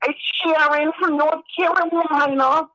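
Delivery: put-on high, slow New York accent; drawn-out, high-pitched Long Island imitation; Sharon, New York accent. Mimicking a woman from Long Island, exaggerated New York accent. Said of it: "sounds like she's from Jersey".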